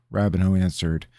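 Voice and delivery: usual speaking voice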